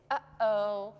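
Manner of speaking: "Uh-oh" broken by a catch in the throat; glottal stop. Shown as a demonstration. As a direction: close the throat briefly inside "Uh-oh". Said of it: "we call a glottal stop".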